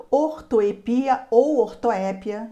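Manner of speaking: ortoepia e ortoépia, devagar, sílaba a sílaba; duas pronúncias. Shown as a demonstration. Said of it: "essa palavra tem dupla pronúncia". (pronúncia dupla, devagar)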